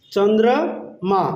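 slow "চন্দ্র - মা" with a gap; broken into syllables. Shown as a demonstration. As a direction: stretch "Chandra", pause, then "মা". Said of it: "চন্দ্রকে আমরা বলবো".

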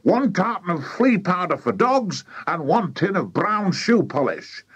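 high, eager announcing; excited cry. A narrator's reading. Mister Killycranky brings the missing ingredients, excited cry. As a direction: proudly call out each item. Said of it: "cried Mister Killycranky".

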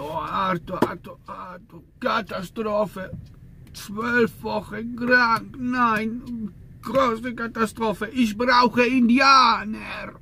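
hammed-up despairing boss, dragging every word; wailing boss. Boss laments in the office. (übertrieben jammernder Chef, langsam und hoch)

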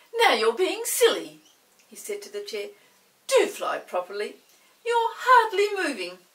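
loud, scolding, rising high at the end; impatient Chinky. Acted. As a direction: push the lazy chair on. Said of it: "Chinky got quite impatient".

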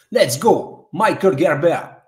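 loud, punchy call-up; hype announcement. Real, not acted. Host calling up next artist, loud hype. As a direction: fire up the crowd for the next act.

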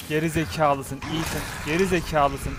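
ordinary talk, nothing marked

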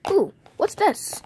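high, slow curious ooh; surprised. Acted. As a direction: act surprised finding something. Finds gum, acts surprised.

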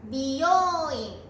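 "美容 院" with a separate bi before long yō; careful contrast. Shown as a demonstration. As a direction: enunciate "美容 院" as bi-yo-o-i-n. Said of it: "よく似た発音の言葉".

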